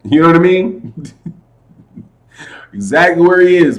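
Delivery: usual speaking voice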